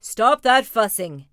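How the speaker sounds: loud, slow fatherly command; loudest Papa Bear voice. Acted. Papa ordering the cubs to stop, loud slow shout. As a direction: boom it out as an exasperated dad. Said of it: "in his loudest Papa Bear voice".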